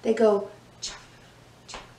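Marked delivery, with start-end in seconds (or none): none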